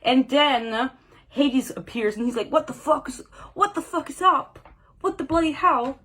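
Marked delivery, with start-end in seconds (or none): 2.5-6.0 higher-pitched, badly faked British accent; attempted British accent. Reader voicing Hades appearing, failed British accent. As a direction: have a clumsy go at sounding British. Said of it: "that's not British".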